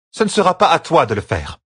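high-pitched voice of a stern sister; firm, authoritative Séonide. Acted. Séonide forbids Perrine his revenge, firm and high. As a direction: overrule him firmly.

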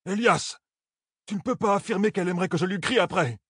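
high-pitched heated protest; indignant. Acted. Perrine protests to Elias.

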